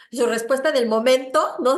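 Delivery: normal talking voice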